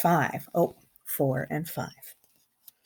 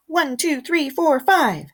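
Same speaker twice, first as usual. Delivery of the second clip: higher, louder each step; counting the steps; 8 semitones higher, 5 dB louder than usual. Shown as a demonstration. Girl running up her five steps, counting excitedly. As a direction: bound up the count. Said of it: "I run up the steps like this".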